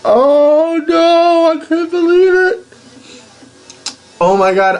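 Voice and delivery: high, loud, thrilled; giddy disbelief. Real, not acted. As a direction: still reeling with joy. Reaction continues.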